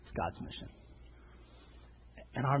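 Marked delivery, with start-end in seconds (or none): 0.0-0.7 whispered. Acted. Preacher whispers the key point to the congregation. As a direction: whisper it: God's mission. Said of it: "The third is God's mission".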